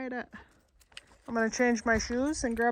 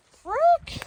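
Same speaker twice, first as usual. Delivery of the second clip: startled yelp; 3 dB louder than usual. Real, not acted.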